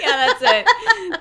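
slow, laughing through words. Real, not acted. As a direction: agree while cracking up. Agreeing while laughing.

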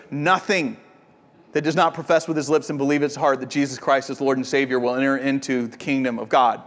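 rattled off fast like a memorized formula; fast, flat recital of the same line to the guest; brisk rote repeat. Retold or imitated. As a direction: run through it quickly and mechanically, no weight. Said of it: "Wade turned and looked at me and goes".

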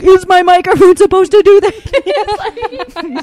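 slow, high, loud mock squeak with a laugh; mocking imitation. Host mocks the guest's mic check, slow high laughing squeak. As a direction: ham up his startled mic question, slow and high. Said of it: "you're like".

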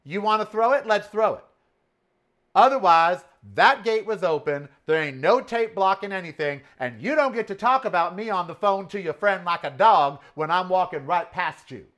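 loud, high street tough talk. Retold or imitated. Telling off the guard, loud and tough. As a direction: square up and threaten. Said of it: "I said".

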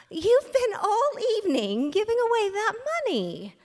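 can't believe what he did; incredulous Dorothy. A narrator's reading. Dorothy questions Harry about the money, incredulous.

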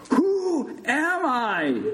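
slow, high, drawn-out holler; shout. A narrator's reading. Big Bird inches from patient's face, shouting. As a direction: stretch the question into a slow yell. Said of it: "Big Bird shouted again".

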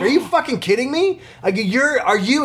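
high-pitched, incredulous outraged yell without much volume; retold yelling. Man confronting a dog owner who walks away, retold high outraged yelling. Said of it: "I just started fucking yelling at her".

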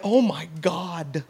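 low, slow and soft; stunned, earnest. Retold or imitated. Groom-to-be realising he must get insurance, stunned. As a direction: let it sink in, hushed. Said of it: "And not, not in vain".

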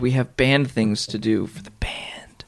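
breathy slow whisper; mock whisper. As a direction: whisper it like a secret. Mock secretive band talk.

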